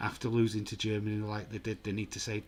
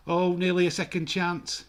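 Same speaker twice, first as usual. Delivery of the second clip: sudden loud, high burst at a near goal; loud excited near-miss shout; excited outburst; 7 semitones higher, 8 dB louder than usual. Real, not acted. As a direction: jump up at the chance, loud. Near miss in live commentary.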